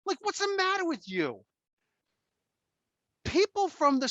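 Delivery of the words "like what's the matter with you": high incredulous yelling; father yelling at the customers; retold outrage. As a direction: demand an answer, outraged. Still yelling at daughter.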